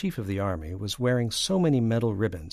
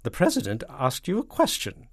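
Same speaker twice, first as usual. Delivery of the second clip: raised, loud, icy reprimand; 6 semitones higher, 6 dB louder than usual. A narrator's reading. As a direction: demand an answer coldly. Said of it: "in an icy voice".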